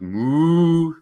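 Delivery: high rising mock moo. Puppy George mooing, high and playful. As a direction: moo like a cartoon cow. Puppy moos instead of barking.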